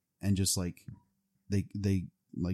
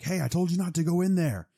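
high, loud imitation of an angry guard; mock angry scolding; 10 semitones higher, 5 dB louder than usual. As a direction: shout a retold telling-off. Imitating bodyguard scolding the intruder.

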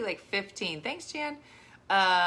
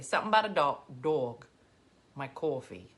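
imitating Jersey vowels on "dog" and "coffee"; mock Jersey accent, dropping lower; 6 semitones lower than usual. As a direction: say "dawg" and "cawfee" like New Jersey. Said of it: "we could have been from anywhere".

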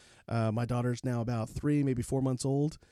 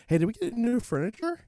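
high, louder mock-casual question; hopeful asking; 11 semitones higher, 5 dB louder than usual. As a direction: pitch it way up, fishing for good news. Husband retells his anxious hopeful question.

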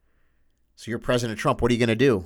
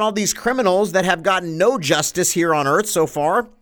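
usual speaking voice

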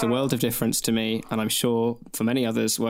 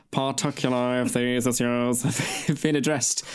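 Alan Rickman impression. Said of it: "I sounded a bit like Alan Rickman then".

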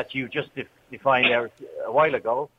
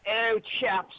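put-on higher, softened upper-class accent; mock posh chap; 6 semitones higher, 4 dB quieter than usual. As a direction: play along as a posh rugby boy. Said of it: "the boys in the rugby clubs".

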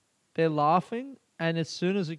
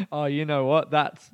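ordinary talk

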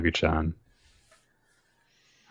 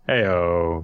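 usual speaking voice

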